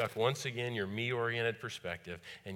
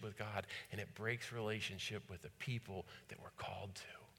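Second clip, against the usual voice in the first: whispered emphasis; 8 dB quieter than usual. Real, not acted. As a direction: drop to a whisper for weight. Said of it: "hushed emphasis in sermon".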